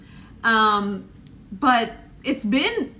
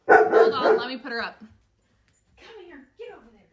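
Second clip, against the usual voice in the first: ordinary talk, nothing marked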